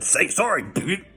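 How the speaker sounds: lightly voiced bully snapping at him; mock tough guy. Retold threat, second telling.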